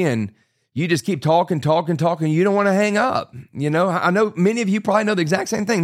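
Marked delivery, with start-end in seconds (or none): none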